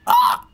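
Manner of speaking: sudden very high squeal; sharp high cry of fright; startled yelp. Real, not acted. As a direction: shriek as the jump lands. Leaping onto thin wood.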